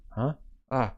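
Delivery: ordinary delivery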